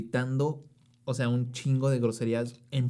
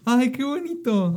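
touched gush; 12 semitones higher, 6 dB louder, slower than usual. Real, not acted. Gushing over the circle.

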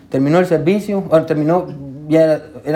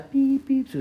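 high, soft hospital-monitor beeps; imitated machine beep; 11 semitones higher, 5 dB quieter than usual. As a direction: mimic a monitor's thin beeping. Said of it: "obviamente la máquina". (pitido agudo de máquina imitado)

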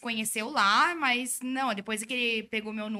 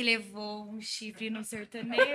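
laughing; 5 dB quieter than usual. Real, not acted. Teasing boyfriend about his ex.

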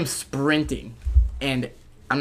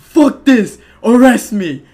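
much higher, louder defiant shout; 7 semitones higher, 10 dB louder, slower than usual. Retold or imitated. Yelling at the cop after getting up, louder. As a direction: yell it at the cop.

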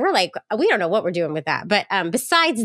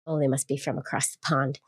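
usual speaking voice

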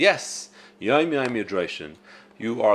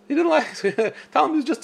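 laughing; 13 semitones higher, faster than usual. Real, not acted. Laughing at the man's jab.

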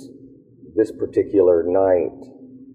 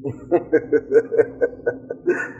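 breathless laugh; 9 semitones higher than usual. Real, not acted. Laughing at the memory.

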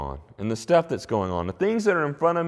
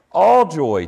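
All joy punched out louder, falling on All; stress on all joy; 2 semitones higher, 7 dB louder than usual. Shown as a demonstration. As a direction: hit All hard, then let joy fall. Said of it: "It's emphatic there in the text".

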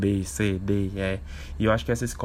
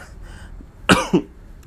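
coughing fit. Real, not acted. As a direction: cough hard, then apologize. (tosse forte e repentina)

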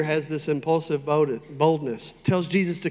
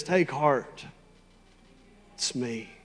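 Jesus; 2 semitones lower, 5 dB quieter, slower than usual. Acted. Jesus in a storm, contrasted with panicking people, calm. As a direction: drop to quiet, unhurried reassurance. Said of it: "Jesus is in a storm and says".

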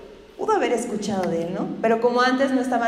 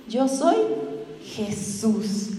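gentle Jesus; 4 dB quieter, much slower than usual. Retold or imitated. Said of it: "Le dijo".

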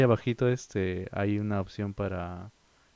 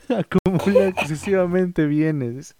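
laughing; 8 semitones higher, 7 dB louder, slower than usual. Real, not acted. Reading insults, laughing.

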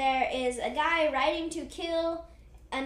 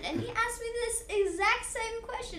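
higher-pitched, lightly voiced adventurer; rambling storyteller; 6 semitones higher, 5 dB louder than usual. Glenn explains his surname to the wizard, lightly played high rambling voice. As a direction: launch into a long tale in a raised pitch.

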